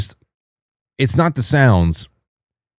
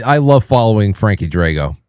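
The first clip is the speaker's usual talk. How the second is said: ordinary delivery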